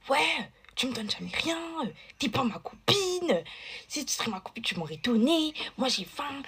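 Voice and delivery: mock a spoilt friend's outburst; imitating a tantrum. Volvic throwing a fit over sweets, whiny and high. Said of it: "elle a commencé à péter un câble".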